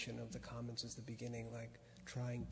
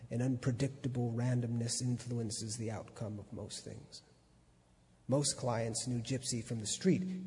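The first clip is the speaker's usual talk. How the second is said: steady reading voice, a touch louder; story narration; 7 dB louder than usual. A narrator's reading. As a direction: read the essay aloud to an audience. Reads the essay aloud.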